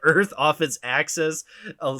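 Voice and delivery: ordinary talk, nothing marked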